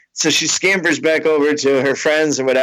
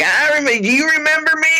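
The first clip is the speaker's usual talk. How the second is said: put-on drunk lady fan; 13 semitones higher, faster than usual. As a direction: call it out like a tipsy fan. Drunk fan voiced in story.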